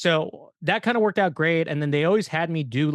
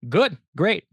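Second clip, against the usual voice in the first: gleeful; 5 semitones higher, 6 dB louder than usual. Retold or imitated. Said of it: "when he died, I'd be like".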